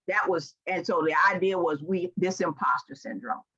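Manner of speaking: ordinary talk, nothing marked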